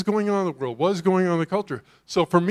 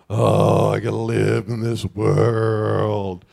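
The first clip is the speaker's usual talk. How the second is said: much lower, slow, mocking lament; 8 semitones lower, slower than usual. Pastor mocks Christians longing to leave the world, low slow lament. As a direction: groan it like a weary believer longing to escape. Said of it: "It shouldn't be like".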